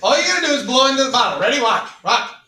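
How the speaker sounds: fast, loud urging; loud cheering on. Real, not acted. Cheering student on to blow.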